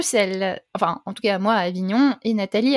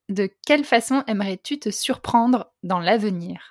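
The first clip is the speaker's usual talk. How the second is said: ordinary talk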